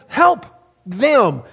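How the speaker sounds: a sudden firm command. Retold or imitated. Pastor voicing God telling him to help, commanding. God's command in retold scene.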